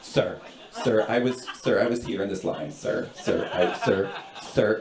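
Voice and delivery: low, soft, nagging interruptions; quietly persistent, patronizing stranger; condescending woman. Retold or imitated. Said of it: "she turns to me and she goes".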